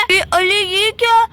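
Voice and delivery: normal talking voice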